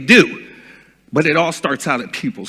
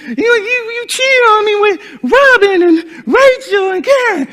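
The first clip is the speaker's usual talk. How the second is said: much higher, giggly accusing voice; high mock Cheryl voice; 4 dB louder than usual. Retelling Cheryl accusing him, in a high mock voice. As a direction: squeak the accusation like an unstable woman.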